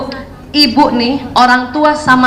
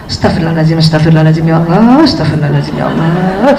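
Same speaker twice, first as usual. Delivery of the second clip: murmured istighfar; 10 semitones lower than usual. Retold or imitated. Mother praying under breath.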